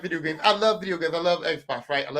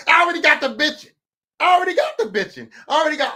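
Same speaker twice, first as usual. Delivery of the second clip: higher, louder retold griping; mock complaining; 6 semitones higher, 5 dB louder, faster than usual. Dad retelling how his kids already started griping, mock complaining. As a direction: imitate kids griping about the trip. Mocks kids' complaining about cruise.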